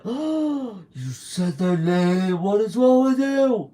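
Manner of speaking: high, shrill-free squeal of outrage, hammed up; mock outraged fan. Mocking a fan who is aghast at hearing the name. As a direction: play the scandalised superfan. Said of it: "I don't go like".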